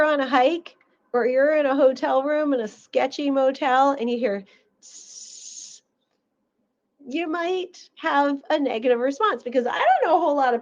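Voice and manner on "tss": slow hissing tss like a snake; drawn-out snake hiss. Retold or imitated.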